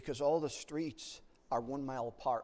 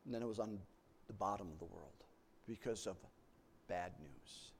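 ordinary delivery

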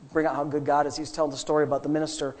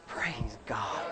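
whispered praise; 8 semitones lower, 11 dB quieter than usual. Real, not acted. Whispered aside.

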